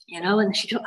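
ordinary talk, nothing marked

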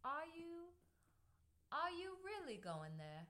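high start sliding down, mock English accent; badly faked English question, falling at the end; downward English inflection, accent. Shown as a demonstration. Host demonstrating English questions, high-pitched accent. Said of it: "ask questions with a down inflection".

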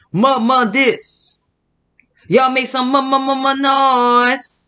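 half-hammed DJ shouting for crowd noise; hype DJ. Imitating the DJ asking the crowd for noise, mildly hyped.

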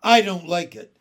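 very loud, blustering captain; firm displeasure. Acted. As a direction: declare it forcefully to the officers. Captain alarmed at hovering ball.

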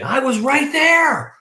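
high, drawn-out comic insistence; brazen insistence. Retold or imitated. Friend claims he saw every shot, brazenly insistent. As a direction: belt the punchline slowly, way up high.

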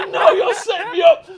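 high, loud accusing cry; loud laughing accusation; paranoid shout. Retold or imitated. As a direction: yell it while laughing. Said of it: "I was like".

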